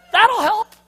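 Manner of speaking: sarcastic high jab, suddenly loud; mock the listener from inside their head; inner critic. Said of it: "your little voice".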